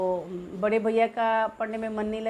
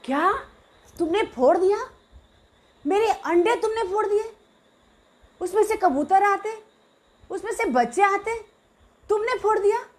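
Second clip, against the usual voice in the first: put-on high, loud childish wail of disbelief; shocked disbelief; 10 semitones higher, 5 dB louder than usual. Little girl to the brother who broke her eggs, shocked high wail. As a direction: cry out as if your treasure is smashed. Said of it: "मेरा तो ये धक हो गया".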